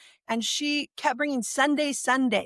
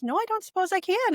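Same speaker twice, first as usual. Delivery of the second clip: lightly imitated sing-song, pitched much higher; 10 semitones higher than usual. The mentor answering the angry caller, in a sing-song voice. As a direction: mimic her gentle sing-song reply. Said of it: "in her sing-song voice".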